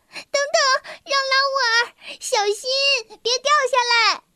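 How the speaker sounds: put-on high, fast girl's warning cry; a worried little girl calls up high. A girl calls to Jean-Raoul on the railing, high and urgent. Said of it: "一个女孩叫了起来".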